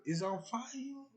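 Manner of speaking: mock disbelief, drawn out; puzzled surprise. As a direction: sound baffled by what she said. His puzzled reply in dialogue.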